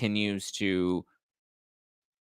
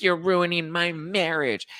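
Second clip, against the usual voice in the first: mock accusing newbie; 8 semitones higher, slower than usual. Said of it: "she's like".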